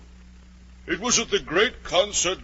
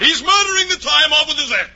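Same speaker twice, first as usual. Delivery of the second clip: much higher, loud shrieking Queen; Queen bawling; 13 semitones higher, 7 dB louder than usual. Retold or imitated. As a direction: screech it like a furious queen. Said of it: "the Queen bawled out".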